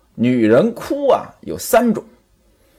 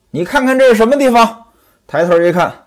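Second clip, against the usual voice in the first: normal talking voice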